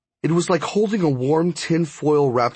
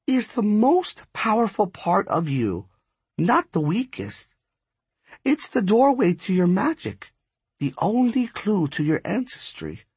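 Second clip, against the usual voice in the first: high-pitched, measured pronouncement; slow, deliberate; 9 semitones higher than usual. A narrator's reading. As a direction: reveal it slowly, word by weighty word. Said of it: "she said slowly".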